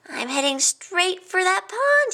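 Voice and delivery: high, slow, pleased anticipation; grinning. A narrator's reading. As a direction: say it through a confident grin. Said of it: "he grinned".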